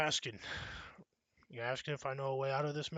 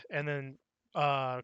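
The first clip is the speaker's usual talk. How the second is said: ordinary delivery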